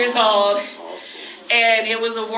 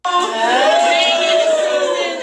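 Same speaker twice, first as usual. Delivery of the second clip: high, drawn-out call across the room; 4 dB louder, much slower than usual. Real, not acted. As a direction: call their names out loud. Calling out friends in the crowd.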